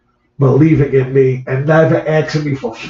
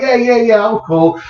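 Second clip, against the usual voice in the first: mock brush-off; 8 semitones higher than usual. Crew asks, he insists fine.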